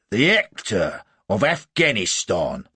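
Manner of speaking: loud, slow, drawn-out exclamation; sergeant's cry. A narrator's reading. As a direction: proclaim it slowly like a sergeant. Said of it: "cries the sergeant".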